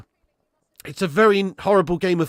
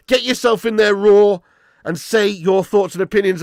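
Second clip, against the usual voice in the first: loud, high, pumped-up call to the chat; rally the viewers, louder and higher; excited hype; 4 semitones higher, 7 dB louder than usual. Real, not acted. Streamer to his chat after the win, hyped and loud.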